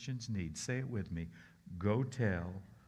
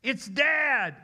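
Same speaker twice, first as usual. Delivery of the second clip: put-on high, loud child announcing; excited child; 10 dB louder than usual. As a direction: blurt it out like a thrilled kid. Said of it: "one of his kids said".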